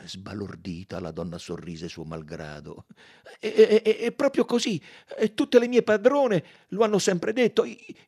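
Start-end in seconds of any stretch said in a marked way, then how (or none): 3.4-7.6 high, loud, stammering eagerness; flustered maid. Acted. Maid agrees that her employers praised her, flustered and eager. 7.6-8.0 high, broken-off stutter; frightened stammer. A narrator's reading. Maid breaks off mid-sentence, stammering in fear. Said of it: "si interruppe a bocca aperta, di nuovo impaurita".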